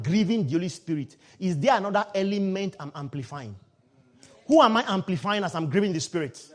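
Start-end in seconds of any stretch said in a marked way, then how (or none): none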